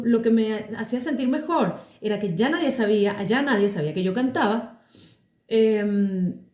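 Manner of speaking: normal talking voice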